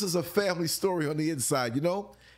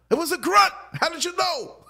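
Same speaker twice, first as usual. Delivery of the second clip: incredulous; 14 semitones higher, 6 dB louder than usual. Real, not acted. Incredulous punchline.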